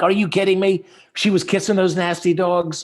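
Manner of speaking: high, loud retold disbelief; incredulous. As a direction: act out your own outraged disbelief at the patient. Dentist retelling his incredulous reply.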